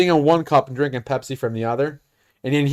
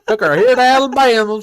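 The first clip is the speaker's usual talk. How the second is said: higher put-on country twang; 7 semitones higher, 3 dB louder than usual. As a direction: imitate the country customer, pitched up. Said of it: "he hates when I do the, the country voice".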